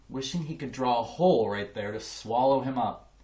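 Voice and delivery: ordinary delivery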